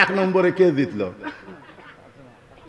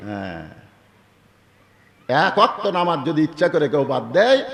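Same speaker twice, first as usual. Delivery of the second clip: usual speaking voice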